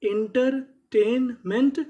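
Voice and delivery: teacher modelling इंटरटेनमेंट; slow drawn-out demo. Shown as a demonstration. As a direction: sound out इंटरटेनमेंट slowly. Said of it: "तो पूरी meaning".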